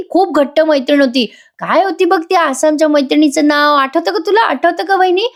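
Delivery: butter her up in a high sing-song to confuse her; fake sweet brother-in-law. [name] coaxing his sister-in-law, sugary high fake friendliness.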